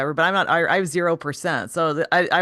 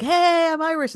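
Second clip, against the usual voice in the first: much higher, drawn-out gushing greeting; mock eager American; 11 semitones higher than usual. American tourist greeting Irish locals, mock eager. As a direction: play an over-keen tourist, pitched way up. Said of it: "Americans go, they're like".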